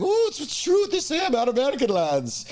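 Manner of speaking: high put-on Irish lass voice; high coy Irish lass voice; Irish girl. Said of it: "she's like".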